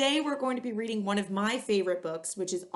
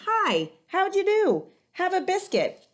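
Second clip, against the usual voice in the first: cheerful greeting; 11 semitones higher, 7 dB louder, slower than usual. Acted. Boss greets the dog.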